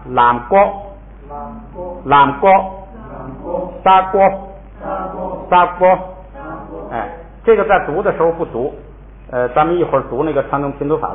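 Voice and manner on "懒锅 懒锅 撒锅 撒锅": 懒锅 and 撒锅 read out as model syllables for the class; teacher reading aloud. Shown as a demonstration. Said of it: "咱们也读一下啊". (带读懒锅、撒锅)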